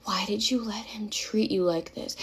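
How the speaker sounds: slow, whispery imitation; hushed mock-sympathetic question; mock whispered concern. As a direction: whisper it as if deeply concerned, mockingly. Mimics his concerned question.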